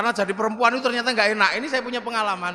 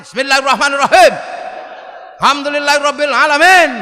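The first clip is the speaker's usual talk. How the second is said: booming, much louder recitation; Sayyidina Umar's forceful recitation; 2 semitones higher, 11 dB louder than usual. Retold or imitated. Preacher imitating Umar reciting Quran, loud forceful recitation. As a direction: recite it like a stern warrior blasting the hall. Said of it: "Ini Sayyidina Umar".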